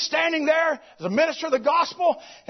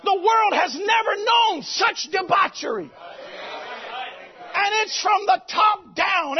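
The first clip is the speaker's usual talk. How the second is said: full-force preaching; 5 semitones higher than usual. Acted. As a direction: preach it out to the congregation.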